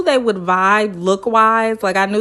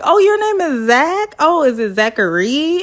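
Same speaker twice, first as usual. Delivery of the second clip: high, mock-surprised "Oh, your name is Zach?"; surprised bride; 6 semitones higher than usual. Michaela learning the groom's name, mock surprise. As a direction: lightly voice Michaela, playfully startled. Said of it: "Michaela's like".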